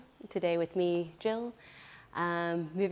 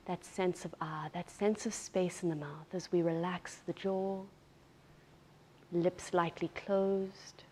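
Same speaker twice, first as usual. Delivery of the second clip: guided meditation. Real, not acted. Yoga teacher settling students into breath awareness, unhurried guided meditation. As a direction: ease them into stillness, leaving space between phrases.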